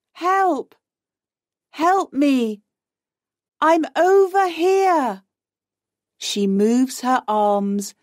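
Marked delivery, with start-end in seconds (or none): 0.1-5.2 calling for help. A narrator's reading. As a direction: call high to a faraway boat. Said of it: "She calls to it".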